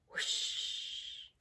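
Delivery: breathy unvoiced whoosh like a vent; whooshing air. Reading a plane book to a child, airy whoosh. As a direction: blow the word out as rushing air.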